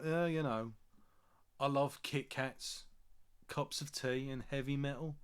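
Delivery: a light, badly done Birmingham accent; a self-mocking, so-so Birmingham accent, slowed down. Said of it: "That was my terrible Birmingham accent".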